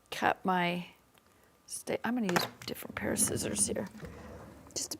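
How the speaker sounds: hushed whispered aside; whispering. Real, not acted. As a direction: whisper it while you work. Aside while cutting on camera.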